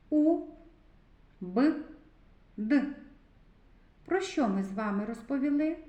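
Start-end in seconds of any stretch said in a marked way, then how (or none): 0.1-2.8 "У, Б, Д" as three isolated sounds; separate sounds. Shown as a demonstration. Teacher pronouncing sounds together with children, "У, Б, Д" each on its own. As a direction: say "У, Б, Д" apart, with long pauses between. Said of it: "вимовимо звуки".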